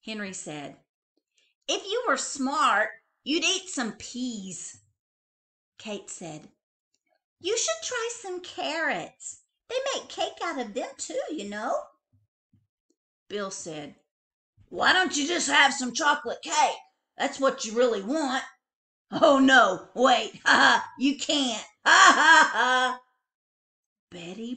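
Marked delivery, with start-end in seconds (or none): 1.7-4.8 teasing brother. A narrator's reading. Brother teases sister. 7.4-11.9 know-it-all sister. A narrator's reading. Sister gives advice. 14.7-23.0 loud teasing brother cackling; big brother laughing at her; mocking laugh. A narrator's reading.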